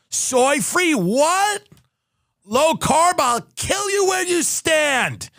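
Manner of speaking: escalating high shouting fury at each claim; mock outrage. Ad host screaming at cereal claims in mock fury.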